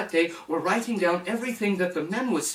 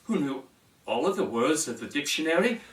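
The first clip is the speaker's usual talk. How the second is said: plain reading voice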